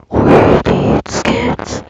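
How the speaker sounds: very slow, loud creepy growl. Pretend. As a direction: drag it out like a monster. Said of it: "that's my creepy voice".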